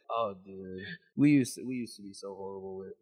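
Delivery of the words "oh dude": ordinary talk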